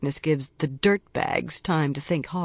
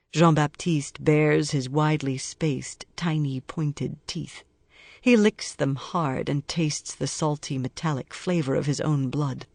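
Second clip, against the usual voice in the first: ordinary delivery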